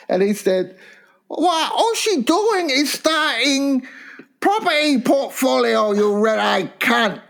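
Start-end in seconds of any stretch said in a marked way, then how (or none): 1.3-7.2 [name], mock Chinese accent. Quoting his Chinese mate's verdict on the property portfolio, high mock Chinese accent. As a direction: imitate a Chinese mate lecturing, pitched way up. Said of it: "my Chinese mate".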